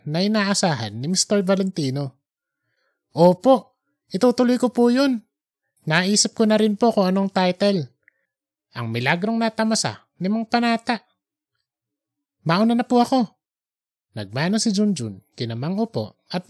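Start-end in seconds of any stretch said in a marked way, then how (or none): 3.1-13.3 higher, brighter resolve; eager young man. Acted. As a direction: answer with new excitement. Junjun answers eagerly. (masiglang binata)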